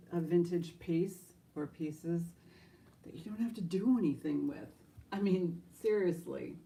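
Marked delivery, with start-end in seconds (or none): none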